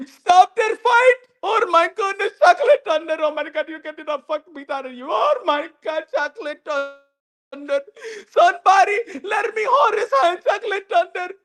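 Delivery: Turkey crying for his fighter, hammed-up; mock crying plea. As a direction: beg through fake tears. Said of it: "gonna be crying like hell".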